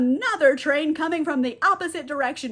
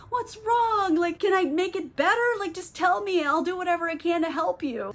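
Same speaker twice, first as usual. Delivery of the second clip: higher, pleading sweet voice; earnest concern; 5 semitones higher than usual. Retold or imitated. Alex comforting distraught Cleopatra, earnestly pleading. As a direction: imitate an anxious, eager-to-help sweetheart.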